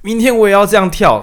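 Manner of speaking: loud, high mock eagerness; 假装兴奋. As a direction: play an over-keen fan, voice jumping up. 扮演看表演后跃跃欲试. (夸张地跃跃欲试，又高又响)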